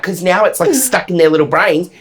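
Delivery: ordinary talk, nothing marked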